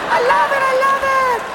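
very high delighted squeal; over-the-top falsetto rapture; ecstatic choreographer. Acted. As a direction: squeal it like a thrilled dance director. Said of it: "ballet choreographer working with a football team".

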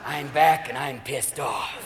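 low, soft, slow menacing comeback; growing threat in a slow, hushed low voice; Ronald Reagan. Acted. President swaggers back into Congress, low slow threat. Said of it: "He's gonna come through next time like".

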